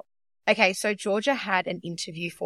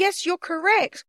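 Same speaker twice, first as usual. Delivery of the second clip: high, slow teacherly praise; imitated approving interviewer; 11 semitones higher, 4 dB louder, slower than usual. Interviewer tells four-year-old she is right, imitated high praise. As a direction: praise a small child, high and drawn out. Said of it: "And she goes".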